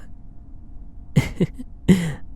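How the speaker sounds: airy soft laugh, barely voiced; a quiet teasing laugh; breathy soft chuckle. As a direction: chuckle under your breath, pleased. Teasing after nibbling the neck.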